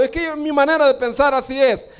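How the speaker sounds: high, smug mimicry of someone trusting their own opinion; mocking a self-sure believer. Preacher mocking a churchgoer who follows his own thinking, high and smug. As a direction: pitch it way up and sound self-satisfied. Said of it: "Tenga cuidado".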